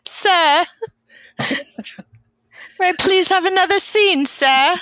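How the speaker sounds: high, pleading urchin voice; Dickensian street urchin. Acted. Joking as an urchin actress begging the director for a scene, mock street urchin. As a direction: beg like a hammed-up Dickens orphan. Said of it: "a Charles Dickens street urchin".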